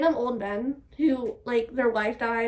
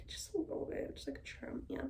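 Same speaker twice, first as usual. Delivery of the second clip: whispering to herself; 16 dB quieter than usual. Real, not acted. Cutting own hair on camera.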